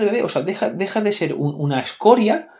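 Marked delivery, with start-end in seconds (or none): none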